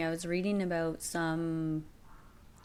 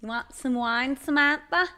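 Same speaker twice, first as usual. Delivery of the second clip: loud, high New York host; mob wife hostess; 6 semitones higher, 7 dB louder than usual. Pretend. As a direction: offer the wine like a mob wife. Mob wife offering wine loudly.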